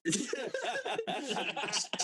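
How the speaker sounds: high burst of laughter. Real, not acted. Laughing at sister story.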